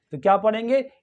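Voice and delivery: ordinary talk, nothing marked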